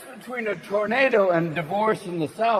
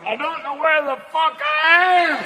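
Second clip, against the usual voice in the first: stoned horse; 7 semitones higher than usual. Retold or imitated. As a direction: whine about cotton mouth. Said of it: "the horse will be going".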